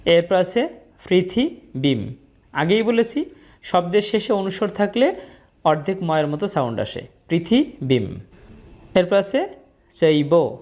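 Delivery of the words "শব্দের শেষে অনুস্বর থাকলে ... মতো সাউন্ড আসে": normal talking voice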